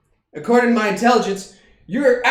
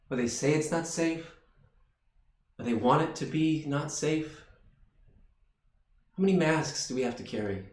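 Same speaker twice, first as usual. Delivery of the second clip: quiet reflection; 6 semitones lower, 9 dB quieter than usual. Acted. Quiet reflection after the shift.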